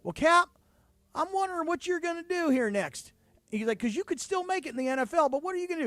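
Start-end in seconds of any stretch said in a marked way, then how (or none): 0.0-3.1 high-pitched imitation of a Southern coach; Southern accent. Coach gently cutting his kicker, imitated Southern accent. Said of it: "He had that Southern accent". 3.8-5.9 Southern coach imitated in a raised pitch; Southern accent. Coach asking a cut player his plans, imitated Southern accent. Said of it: "He had that Southern accent".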